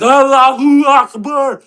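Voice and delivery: drawn-out mock yell; mock shout. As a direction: bellow it out as a prank. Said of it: "going to that airport and going".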